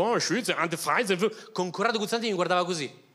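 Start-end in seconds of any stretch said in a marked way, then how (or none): 0.0-1.5 mock gibberish German; hammed-up fake German; fake German accent. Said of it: "facevo un finto tedesco".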